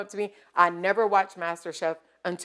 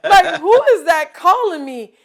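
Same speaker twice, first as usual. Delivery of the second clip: laughing; 10 semitones higher, 10 dB louder than usual. Retold or imitated. Startled, laughing at being called.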